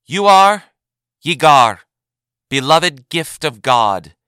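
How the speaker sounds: loud, slow ceremonial naming; proclamation. A narrator's reading. Advocate General names Yagar before the assembly, loud and slow. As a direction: declare the name to all.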